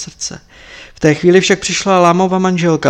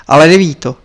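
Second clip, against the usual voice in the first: louder, insistent objection; stubborn objection; 9 dB louder, faster than usual. Acted. Tepaga stubbornly objects to Marpa, insistent. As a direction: dig in against the master. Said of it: "namítá tvrdošíjně".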